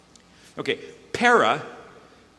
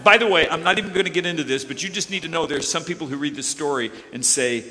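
usual speaking voice

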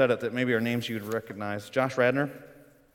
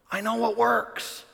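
higher, slow proclamation; confident announcement; 8 semitones higher, slower than usual. Retold or imitated. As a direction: announce it slowly like a big revelation. Voices the man with the answer.

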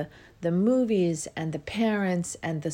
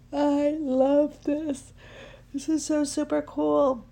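much higher, slow, squealing with delight; excited squeal; 9 semitones higher, 4 dB louder, slower than usual. Real, not acted. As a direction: stretch out "I love this" in a high, thrilled squeal. Gushing over own idea.

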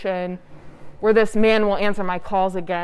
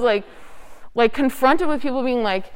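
ordinary delivery

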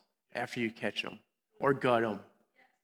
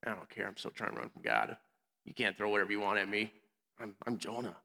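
soft, low-key, couldn't-care-less mumble; indifferent Jonah; 3 semitones lower, 8 dB quieter than usual. Acted. Youth preacher voices Jonah ignoring the storm, indifferent. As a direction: shrug it off quietly, voicing an unbothered runaway. Voicing Jonah, unbothered aboard the ship.

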